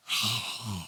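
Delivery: long low exasperated sigh; heavy sigh. Real, not acted. Ranting vlogger lets out a heavy, disgusted sigh. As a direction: let out a deep disgusted sigh.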